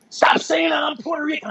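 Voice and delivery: high-pitched indignant protest; mock pissed off. Training partner correcting his friend's introduction, mock pissed off. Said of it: "Get all pissed off".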